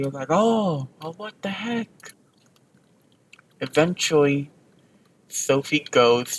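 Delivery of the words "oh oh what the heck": higher-pitched mock indignation; mock angry. The tricked crowd reacts, mock angry. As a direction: act mildly outraged in a raised pitch. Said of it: "they're all angry".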